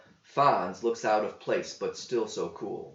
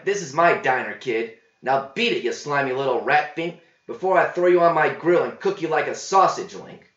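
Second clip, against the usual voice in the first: louder, gruff warning from the cook; cook's threat; 4 semitones higher, 8 dB louder than usual. A narrator's reading. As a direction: throw the kid out.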